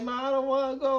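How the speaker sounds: high, whimpering plea to mom; scared whining kid. Retold or imitated. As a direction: beg mom like a frightened child. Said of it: "I started crying, scared".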